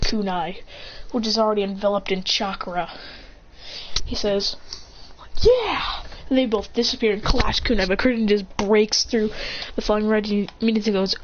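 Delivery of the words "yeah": short, high charging yell; eager attack shout, pitched up; battle cry. Acted. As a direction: burst out with a high fighting cry.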